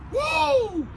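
very high-pitched "woo" of joy; celebratory whoop. Real, not acted. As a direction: let out a long, high whoop. Celebrates the catch.

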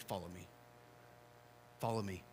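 soft, quiet, tender invitation; hushed gentle call. Acted. Jesus softly inviting Matthew to follow, hushed and tender. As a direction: draw him in with a hush.

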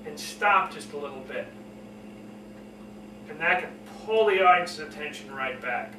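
low, measured, pausing delivery. Shown as a demonstration. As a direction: drop the pitch and let it settle. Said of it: "lower your voice".

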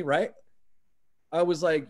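ordinary delivery

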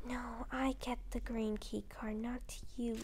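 hushed whisper at the rival. Real, not acted. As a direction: whisper it urgently.

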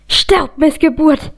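restrained, high-pitched vicious screech. A narrator's reading. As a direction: spit it out like a furious witch. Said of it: "kreischte Selena". (gehässiges, gebremstes Kreischen)

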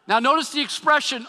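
normal talking voice

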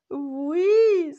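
high, loud, wailing sob; sobbing yes. Acted. The big finger sobs yes into Monsieur Pouce's neck. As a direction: wail it like a heartbroken child. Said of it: "sanglote le plus grand".

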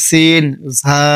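সিন and ঝা voiced apart from the teaching voice; letter demo. Shown as a demonstration. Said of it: "দুই দাঁতের আগার দিকে লাগাইয়া".

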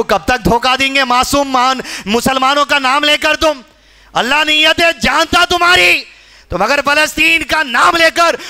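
high, forceful sermon warning; impassioned preaching. Real, not acted. Preacher warning those using Palestine for elections, impassioned.

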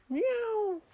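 high, drawn-out imitation meow; cat meow. A narrator's reading. The little cat answers back, high meow. Reader voices the cat's meow.

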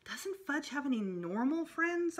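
usual speaking voice

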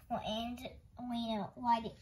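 usual speaking voice

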